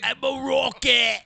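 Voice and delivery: slow British mock shout; mock British rocker. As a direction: draw it out as a punk singer.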